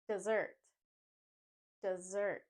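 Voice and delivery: dessert as "duh-ZURT", z sound; weak duh, then strong ZURT in dessert; stress on second syllable. Shown as a demonstration. Teacher modelling dessert for learners, stressed ZURT. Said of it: "Let's go over the pronunciation of dessert first".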